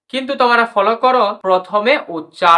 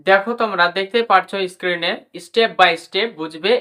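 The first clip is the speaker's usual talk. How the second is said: usual speaking voice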